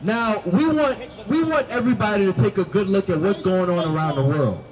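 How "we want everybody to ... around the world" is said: ordinary talk, nothing marked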